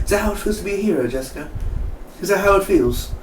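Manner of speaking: high-pitched acted question; imitated hero's wonder. Acts out Kilgrave questioning Jessica.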